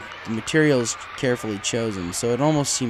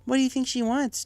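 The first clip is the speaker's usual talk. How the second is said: put-on much higher, curious young mare; Twilight's voice; 13 semitones higher than usual. A narrator's reading. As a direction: lift into a bright questioning girl voice. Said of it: "Twilight asked".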